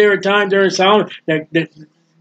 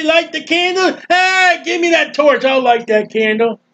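higher mock-gloating voice; jeering invader; 9 semitones higher than usual. Preacher voicing looters mocking the candle, high jeer. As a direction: sneer it like the temple looters.

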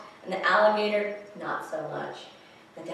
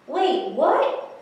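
high, loud double take; mock surprise; 8 semitones higher, 6 dB louder than usual. Reader reacts to the sinking ducks, surprised double take. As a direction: stop short and question it, pitched up.

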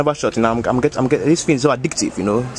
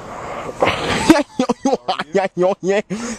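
spluttering laugh after the smoke; coughing laugh; 5 semitones higher than usual. Real, not acted. Uncle coughing after his first shisha. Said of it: "Sorry, I'm just coughing".

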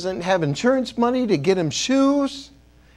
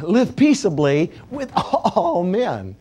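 laughing read; slower than usual. Real, not acted. Laughing after mispronouncing.

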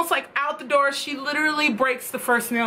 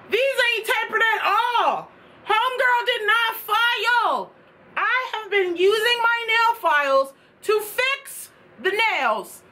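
indignant rant; 8 semitones higher than usual. Real, not acted. Vlogger venting to viewers about unfiled nails. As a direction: vent about the bad filing.

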